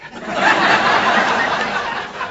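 breathy burst of laughter; laughs. Real, not acted. Storyteller laughing after telling the lieutenant he was just happy. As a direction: laugh at your own line.

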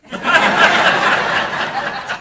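loud high breathy laugh. Real, not acted. Storyteller laughing at fleeing Dave Winfield and the guards, loud high laughter. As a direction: burst out laughing.

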